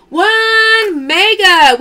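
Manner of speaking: loud, slow and high announcement; big drawn-out game-show call; drawn-out callout. Acted. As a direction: stretch it out like a prize reveal. Calling out pull in live sale.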